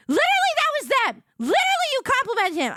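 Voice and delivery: high, loud insistent retort; insistent friend. Retold or imitated. Friend correcting her, high and loud insistence. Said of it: "She was like".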